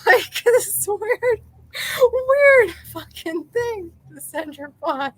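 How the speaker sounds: high, slow, cracking up mid-sentence; laughing. Real, not acted. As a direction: squeal it through helpless laughter. Laughs at own weird text.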